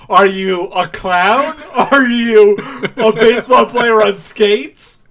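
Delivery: high, loud, drawn-out questioning; mock quiz questions. Host reading out an imagined BuzzFeed quiz, mock quiz voice. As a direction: ask each absurd option slowly and loudly.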